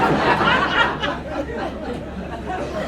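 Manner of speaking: breathy high-pitched laugh. Real, not acted. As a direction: burst out laughing. Laughing at quiz answer.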